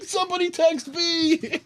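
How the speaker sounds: high, slow insistent call; telling friends to text the one who left, high giddy call; excited. Retold or imitated. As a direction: urge the others, giddy. Retold excitement, urging friends.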